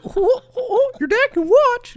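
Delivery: chirpy cartoon mascot; squeaky cartoon voice. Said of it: "He's like".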